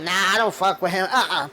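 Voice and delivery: quick, curt brush-off in a different voice; dismissive refusal. Retold or imitated. [name] waving off any link to [name], quick curt refusal. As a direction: wave off the name fast.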